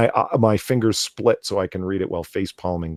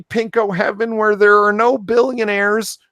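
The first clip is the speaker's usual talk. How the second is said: high-pitched put-on activist voice; mock an earnest larper in a squeaky high pitch; neat larper voice; 12 semitones higher, 3 dB louder than usual. Host mocking an article he can't read normally, high larper voice. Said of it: "the neat larper voice".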